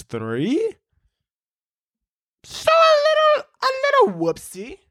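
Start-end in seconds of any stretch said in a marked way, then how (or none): 2.4-4.8 put-on very high, fast, babyish whoopsie; cutesy baby voice. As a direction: make the mistake sound cute.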